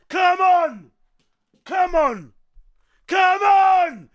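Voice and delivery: ordinary talk, nothing marked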